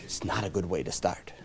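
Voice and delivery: hushed aside; confiding under the breath; whisper. Real, not acted. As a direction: drop to a whisper.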